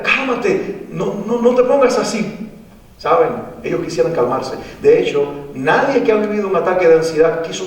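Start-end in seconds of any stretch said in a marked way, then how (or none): 0.0-2.5 high, insistent "Cálmate" from a parent who does not get it; hammed-up impatient hushing, much higher; mock scolding a panicked child to calm down. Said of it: "y que alguien le diga".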